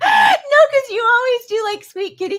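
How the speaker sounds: high, loud laughing no; laughing protest. Real, not acted. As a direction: protest loudly through a laugh. Laughing protest at partner's joke.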